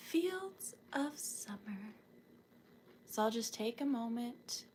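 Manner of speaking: whispery calm guide; soft guided imagery. Acted. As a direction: lead them gently into the picture. Guided imagining of a field.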